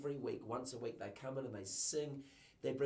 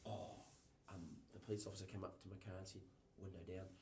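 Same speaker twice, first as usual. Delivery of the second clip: softer, hurried, timid retelling; shy quiet voice; 4 semitones lower, 11 dB quieter, faster than usual. Retold or imitated. Quiet worker recalling the police stop, softly and quickly. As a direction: mumble it quickly like a shy lad. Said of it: "Quite a quiet person".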